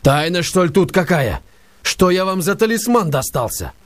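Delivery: high, incredulous questioning; exasperated demand. A narrator's reading. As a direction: throw the question back at him. Stavrogin demanding.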